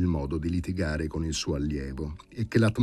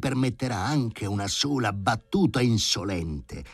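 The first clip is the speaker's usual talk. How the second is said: higher, stern threatening lecture; furious professor; 5 semitones higher than usual. Acted. The professor threatening Sharikov with no dinner, higher and stern. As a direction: threaten to cut off his meals. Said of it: "gridò Filippo Filippovic".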